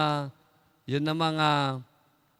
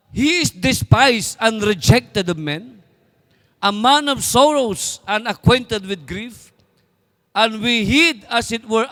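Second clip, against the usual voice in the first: slow, solemn Bible reading; scripture reading; 4 dB louder, slower than usual. Acted. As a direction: read each phrase slowly, weighing it. Reads scripture slowly in English. (mabagal at taimtim na pagbasa ng Bibliya)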